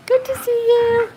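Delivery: very high, slow, loud sing-song; exaggerated high-pitched welcome; gushing greeting. Real, not acted. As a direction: squeal a drawn-out hello.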